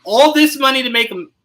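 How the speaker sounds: acted-out indignant disbelief; incredulous protest. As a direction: protest in disbelief, long high NO settling down. Acts out fans' disbelieving reaction.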